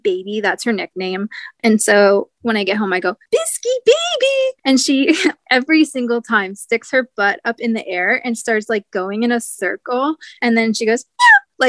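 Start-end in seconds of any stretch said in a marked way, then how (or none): 3.3-4.6 cooing at cat. Retold or imitated. Greeting her cat at home. 11.2-11.7 very high, loud cat meow; high-pitched meow. Retold or imitated. Imitating her cat Biscuit, very high loud meow. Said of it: "really high pitches".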